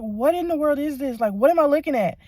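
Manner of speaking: loud, high-pitched bafflement; retold disbelief. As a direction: relive the shock at full volume. Own stunned reaction, retold.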